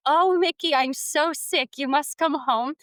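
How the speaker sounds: a mom overplaying a sick Kyrgyz roommate in a bad Kyrgyz accent; mock Kyrgyz accent. Mom faking a sick Kyrgyz roommate on the phone, over-the-top Kyrgyz accent. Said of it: "over-the-top, terrible Kyrgyz accent".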